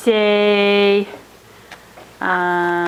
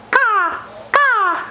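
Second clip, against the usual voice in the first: high bird caw; 10 semitones higher than usual. Retold or imitated. Parent imitates bird for baby.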